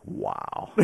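breathless whispered awe; awed breathy whisper; whispered wow. Retold or imitated. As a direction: hush it in amazement. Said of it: "So I was like".

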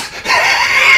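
high wheezing laugh; real laughter. Real, not acted. Storyteller after his punchline, wheezing laughter. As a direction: crack up at your own punchline.

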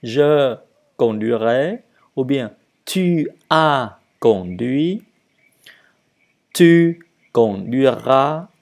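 deliberate, emphatic conjugation drill; repeating for learners. Shown as a demonstration. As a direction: draw each word out for learners to copy. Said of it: "Et donc, je répète".

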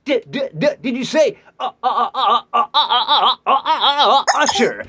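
high, loud, laughing stammer; stammering disbelief. Pretend. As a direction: stutter like you can't believe he asked.

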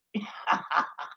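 brief self-amused laugh. Real, not acted. Lecturer unsure of the car's name before students, laughing. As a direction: laugh off your own uncertainty. Laughs at own doubt over Lamborghini.

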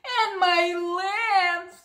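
very slow, bleating sheep voice; a mother sheep, slow and bleating. Acted. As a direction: draw it out like a sad ewe. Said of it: "said the sheep".

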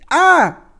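short, high, loud yelp; hammed-up shriek of horror; mock scream. Player yelps at the horrible menu in mock horror.

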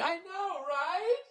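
very high, soft mock girlfriend whine; hammed-up high girlish gossip; mocking gossiping woman. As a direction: squeak it like gossiping friends at a wine bar. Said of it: "with your female friends just going".

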